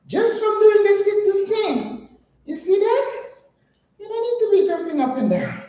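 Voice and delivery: high, out-of-breath talk after exercise; winded. Real, not acted. Trainer telling viewers she's winded, high and breathless. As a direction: still puffing from the sit to stand. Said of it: "because I'm still winded".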